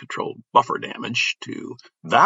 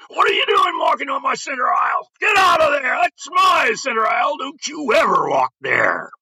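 drill instructor screaming. Retold or imitated. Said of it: "the drill instructor screamed".

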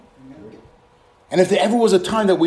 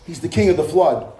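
ordinary delivery

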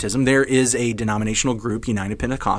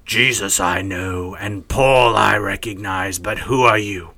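demon voice; 2 semitones lower, slower than usual. Acted. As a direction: answer like a demon sizing up intruders. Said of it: "is that my demon voice".

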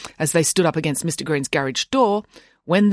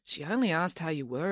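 soft, understated remark; quiet observation; 10 dB quieter than usual. A narrator's reading. Boy calmly correcting his nanny, quietly observing. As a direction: drop the voice right down. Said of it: "observed Derek".

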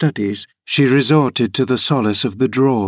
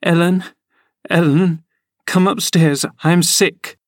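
put-on high, pleading girlish voice; a daughter calling her nurse in panic; frightened girl; 8 semitones higher than usual. A narrator's reading. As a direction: beg your nurse in distress. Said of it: "she gasped".

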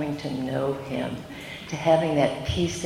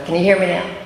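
projecting; 3 semitones higher, 7 dB louder, faster than usual. Real, not acted. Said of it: "Use your theatrical voice".

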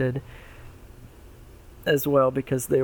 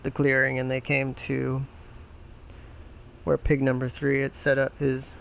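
ordinary delivery, nothing marked

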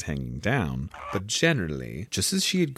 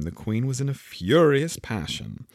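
ordinary delivery, nothing marked

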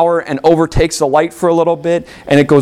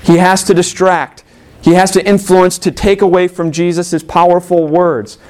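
ordinary talk

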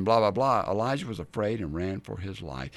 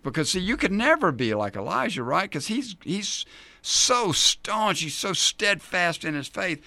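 sarcastic, higher-pitched praise; mock admiration; 6 semitones higher than usual. Preacher teasing listeners who idolise Elijah, mock admiring. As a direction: lay on the awe sarcastically. Mocking the idealised view of Elijah.

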